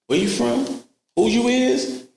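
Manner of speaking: mimicking a cellmate's challenge; mock interrogation. Inmates questioning newcomer.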